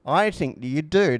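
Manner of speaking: ordinary talk, nothing marked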